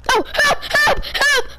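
high repeated cries for help; calling for help. Real, not acted. Kid caught in game, high cries for help. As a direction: cry out for rescue.

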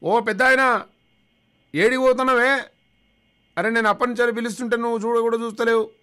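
loud, high shout across the street; shouting at an old man. Retold or imitated. Calling out loudly to an elderly man who won't look back. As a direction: yell to make him turn round. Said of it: "అంత గట్టిగా ఎందుకు అరుస్తున్నావు బాబు?".

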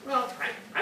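ordinary talk

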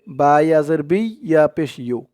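ordinary talk, nothing marked

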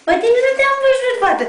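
light up at spotting a new pup; delighted puppy greeting. Real, not acted. Owner greeting an unseen puppy, high and delighted.

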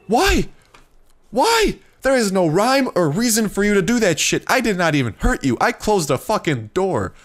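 high, loud, indignant protest; outraged yelling. Real, not acted. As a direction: scream why, then rant at the culprit. Berating creature that attacked him.

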